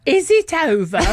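put-on high, loud northern English accent; ridiculous northern accent. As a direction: blurt it in a comically broad northern English accent. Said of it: "in this incredibly ridiculous northern accent".

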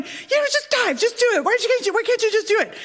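high-pitched, hurried inner voice nagging; urging brain. Retold or imitated. Panicked brain urging herself to dive, high and nagging. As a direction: play a pushy inner voice, high and speeding up. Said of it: "And my brain is going".

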